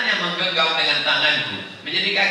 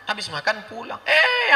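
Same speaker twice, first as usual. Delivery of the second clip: normal talking voice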